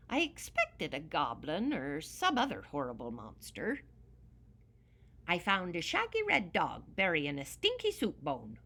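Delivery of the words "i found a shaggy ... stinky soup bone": ordinary delivery, nothing marked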